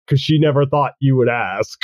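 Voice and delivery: ordinary talk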